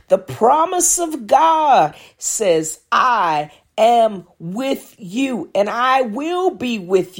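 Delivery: high, ringing preacher's voice pleading with the flock; preaching. Acted. Pastor reassuring the congregation through a storm, preaching. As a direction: lift it up and preach it, drawing out the promises.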